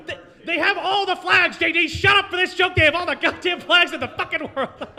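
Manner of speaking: bursting with giddy enthusiasm; over-excited rant. Real, not acted. Comic shouting down heckler J D to push his flag joke, giddy loud.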